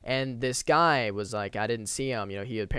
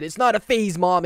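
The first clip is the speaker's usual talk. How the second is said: higher, louder mock whine of a defiant teen; mock emo kid; 5 semitones higher, 7 dB louder than usual. Emo guy mocked as a sulky teen, higher and louder. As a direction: lightly ham up a sulky teen insisting to mom. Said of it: "this guy said".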